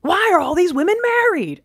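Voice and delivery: high, loud wail of disbelief; mock outcry. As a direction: cry out in exaggerated dismay. Mock outcry over the cards.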